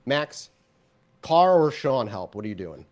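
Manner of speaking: ordinary talk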